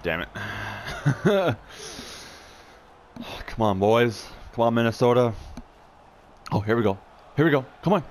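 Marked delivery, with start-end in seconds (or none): 0.3-2.4 high, airy laugh at himself; light high laugh; high breathy laugh. Real, not acted. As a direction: laugh off the miss. Laughs after a missed chance.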